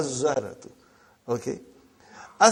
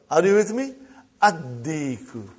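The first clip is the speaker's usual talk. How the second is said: normal talking voice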